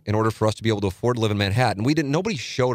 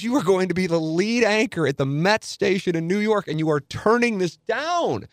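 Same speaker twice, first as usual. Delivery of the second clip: mock yell, pitched way up and dragged out; imitating a screaming agent; 10 semitones higher than usual. Agent berating him on the phone, mock high-pitched yell. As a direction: squeal it like an outraged agent. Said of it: "he just starts screaming at me".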